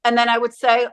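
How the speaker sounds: ordinary talk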